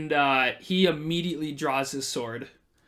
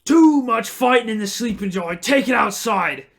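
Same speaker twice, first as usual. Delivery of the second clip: loud, higher barkeep ordering them out; loud scolding; 6 semitones higher, 8 dB louder than usual. Acted. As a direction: bark the order like he owns the place. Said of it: "He goes".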